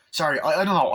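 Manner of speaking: high, loud defensive burst; worked-up apology. Real, not acted. Excusing the outburst at Dana, worked-up. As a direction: still heated from the outburst.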